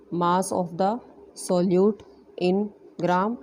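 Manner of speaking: slow, spaced-out repeat for note-taking; deliberate dictating pace; slow dictation. Shown as a demonstration. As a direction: spell it out slowly so students can write. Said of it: "teacher repeats phrase for dictation".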